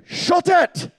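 high-pitched, mock-Scottish outburst; Scottish accent. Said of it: "put that in Scottish for you".

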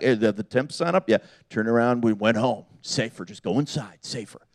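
low, trailing-off mock hikers; muted retreat. Hikers talking themselves into going home, muted mock retreat. Acting hikers backing out at sign.

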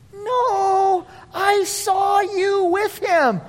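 put-on high, drawn-out sing-song child voice; little girl accusing. Little girl pressing Peter, childish insistence. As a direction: stretch out the accusation like a tattling child. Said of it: "A little girl walks up to him".

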